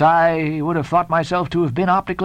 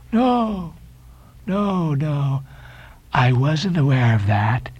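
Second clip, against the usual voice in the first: slow, wondering Elijah; much slower than usual. A narrator's reading. Elijah eyeing the two friends, slowly wondering. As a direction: muse slowly as an odd stranger. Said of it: "slowly and wonderingly looking at me".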